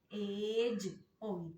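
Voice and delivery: एज drawn out slowly with a clear j sound at the end; soft g sound. Shown as a demonstration. Teacher showing students the soft g, slow and clear. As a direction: stretch out एज so the students hear the j. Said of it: "इसकी sound ज".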